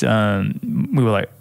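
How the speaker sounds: usual speaking voice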